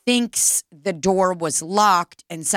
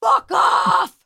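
retold yell, higher and louder; angry yell; 11 semitones higher, 4 dB louder than usual. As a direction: bark it back at the trucker, half-replayed. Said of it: "I just yelled".

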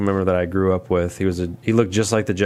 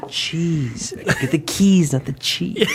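movie quote impression; 5 semitones higher, slower than usual. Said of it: "I said".

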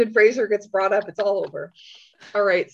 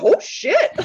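retold shock, much higher and louder; shocked gasp; 6 dB louder than usual. Podcast host reliving her second watch, shocked gasp. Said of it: "full on gasp".

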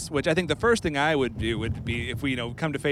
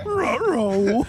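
Scooby-Doo voice; 8 semitones higher, 4 dB louder than usual. Retold or imitated. Scooby-Doo just mentioned, laughing.

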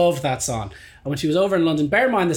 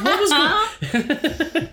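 laughing; 13 semitones higher, much slower than usual. Retold or imitated. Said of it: "He goes".

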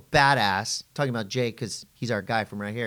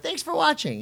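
high, sing-song sarcastic thanks; sarcastic sweetness. Retold or imitated. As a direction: brush off the troll cheerfully. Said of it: "I'm like".

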